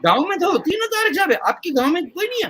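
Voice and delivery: a hint of the cleric, sped up; quoting the maulvi. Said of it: "उन्होंने कहा". (मौलवी की हल्की नकल)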